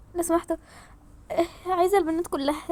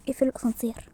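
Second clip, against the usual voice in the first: ordinary talk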